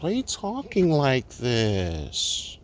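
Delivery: slow, drawn-out mock question; mocking critics. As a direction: drag out each word, mimicking a critic. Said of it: "same way they will say".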